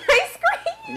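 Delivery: laughing through the words ice cream. Real, not acted. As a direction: laugh out the punchline, amused. Laughing at boyfriend's ice cream treat.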